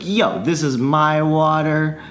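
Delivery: badly faked New Hampshire yell, stretched slow; mock drunk yelling. Drunk dock owner warns kids off his lake, mock yell. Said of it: "He starts to fucking yell".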